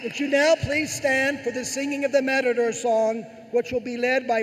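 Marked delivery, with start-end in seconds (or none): none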